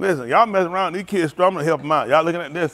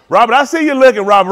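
louder high call-out; 7 semitones higher, 10 dB louder than usual. Real, not acted. Comedian calling out Robert, loud teasing. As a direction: catch him in the act.

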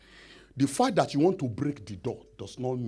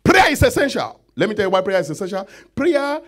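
higher, loud emphatic declaration; loud proclamation; 10 semitones higher, 11 dB louder than usual. Acted. Preacher proclaiming loudly to the congregation. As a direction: announce it to the whole church.

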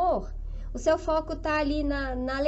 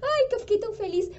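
delighted customer; 5 semitones higher, faster than usual. Retold or imitated. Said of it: "até ela fala assim".

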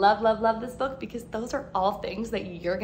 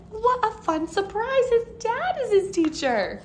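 excited aside; 13 semitones higher, 4 dB louder than usual. Real, not acted. Teacher reacts to story twist.

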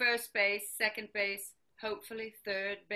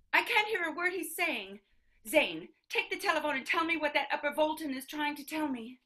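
raised, higher, louder voice; exasperated command; 5 semitones higher, 5 dB louder than usual. Acted. Mother ordering Zane to the phone, loud and exasperated. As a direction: complain loudly, then hand over the order.

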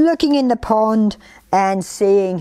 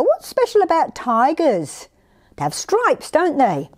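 plain reading voice

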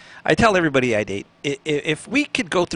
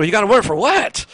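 high, louder, incredulous question; mock disbelief; 6 semitones higher, 5 dB louder than usual. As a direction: act stunned at the news.

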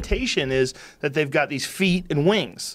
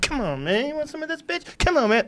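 taunting raven; 12 semitones higher than usual. Retold or imitated. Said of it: "This raven comes over and fucks with one of the cats".

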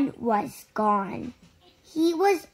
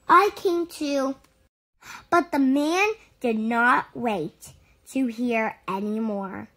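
plain reading voice

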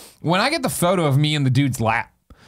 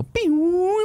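laser pew. Retold or imitated. Imitating laser sound.

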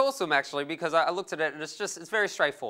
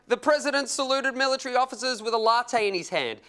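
higher-pitched imitation of a scandalized public; mock outrage; 8 semitones higher, 3 dB louder than usual. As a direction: play America going nuts over nothing. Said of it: "And America went nuts".

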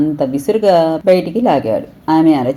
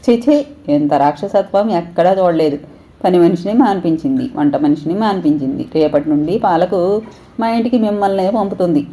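ordinary delivery, nothing marked

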